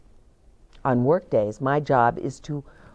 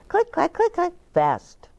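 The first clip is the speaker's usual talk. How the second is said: usual speaking voice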